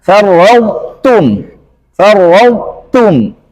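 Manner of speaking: farwautum slow, emphatic t kept; correct farwautum. Shown as a demonstration. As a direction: draw out farwautum. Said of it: "kata yang keempat".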